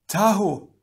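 high, strained cry; high-pitched distressed call; gasped. A narrator's reading. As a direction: gasp the name in alarm. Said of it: "Gali gasped".

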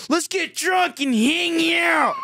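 high, slow stretched-out shout; mocking frontman shout. Friend mocking the band's closing line, slow high shout. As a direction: ham up a bandleader's sign-off. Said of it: "Why did you say it like that".

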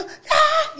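high laughing mock scream; retold scream. Retelling her scream on the bumpy plane, high mock scream. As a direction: shriek it as in the plane. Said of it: "Y yo".

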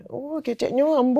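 high-pitched Minang family banter; Minang relatives chatting. Retold or imitated. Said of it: "keluarga nyokap gue".

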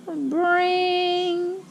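long high "brrring"; phone ring imitation. A narrator's reading. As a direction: imitate a ringing telephone on one held high note. Imitates the phone ringing in story.

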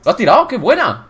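high, loud, delighted praise; thrilled cheering. Real, not acted. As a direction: cheer your teammate's move at high pitch. Celebrates teammate's knockout.

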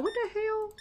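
high, squeaky what the hell; baffled. Retold or imitated. Cashier baffled by bread prices, high and squeaky. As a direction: act stunned by the price. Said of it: "Be like".

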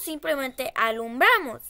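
normal talking voice

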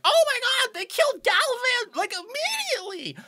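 very high, squeaky imp outcry; shocked imp. Acted. Game master voices crowd imps seeing Galvan killed, shocked high voice. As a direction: cry out in horror as a tiny imp. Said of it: "some of the imps go like".

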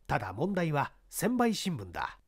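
plain reading voice

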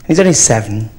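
normal talking voice

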